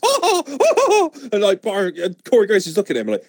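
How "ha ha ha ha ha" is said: high laughter. Real, not acted. Laughing at own impression.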